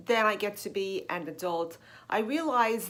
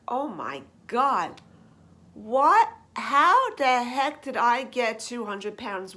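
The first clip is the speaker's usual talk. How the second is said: shocked disbelief; 3 dB louder than usual. Retold or imitated. Speaker reliving stepping on the scale, shocked outcry. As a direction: gasp it out like the number can't be real.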